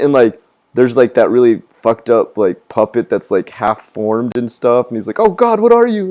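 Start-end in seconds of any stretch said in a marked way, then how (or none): 5.2-6.1 high-pitched imitation of horror; hammed-up appalled reaction; mock horror. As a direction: recoil vocally, voice jumping up. Acts out reaction to puppet.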